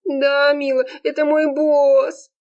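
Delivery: high, drawn-out wail; moaning lament. A narrator's reading. Serafima admits it's her boss, moaning. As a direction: wail the confession in a high, miserable voice. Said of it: "продолжала стенать Серафима".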